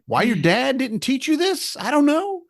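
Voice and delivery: high, hammed-up calming voice; imitating himself soothing them; mock soothing. Repairman calming panicked kid, mock soothing. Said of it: "I'm like".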